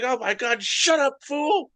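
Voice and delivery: high, squealing delighted mom; thrilled mom. Retold or imitated. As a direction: gush like she can't believe the deal. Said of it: "just orgasmic about the price".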